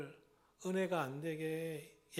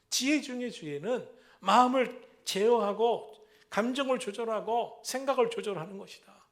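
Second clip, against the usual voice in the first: forceful preaching; 6 semitones higher, 10 dB louder than usual. Real, not acted. Preacher to congregation, forceful preaching. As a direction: drive the point home from the pulpit.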